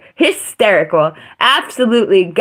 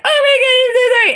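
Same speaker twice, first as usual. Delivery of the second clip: much higher, panicky mock apology, fast at the end; frantic apology; much faster than usual. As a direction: squeal out a frantic, grovelling sorry. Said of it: "He's like".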